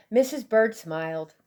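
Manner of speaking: plain reading voice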